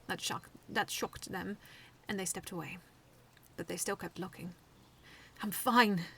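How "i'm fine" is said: growled. A narrator's reading. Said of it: "I growled".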